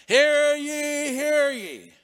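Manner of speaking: loud, high crier shout; town crier call. Retold or imitated. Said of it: "with a voice loud and clear".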